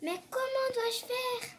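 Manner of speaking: put-on high, slow little-boy question; timid boy. A narrator's reading. Boy asking the portrait how, high and timid. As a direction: ask it small and unsure.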